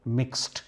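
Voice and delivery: మిక్స్డ్ ending in a crisp t, no vowel after; మిక్స్డ్ said as mikst with a clipped final t; correct pronunciation. Shown as a demonstration. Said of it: "ఇక్కడ డీని టీగా పలకాలి".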